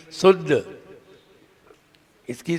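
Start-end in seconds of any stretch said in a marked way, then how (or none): 0.1-0.8 शुद्ध said "suddh" with plain "s"; "s" as in सड़क. Shown as a demonstration. Teacher spells out the mistake, शुद्ध as "suddh". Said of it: "सड़क वाले की spelling".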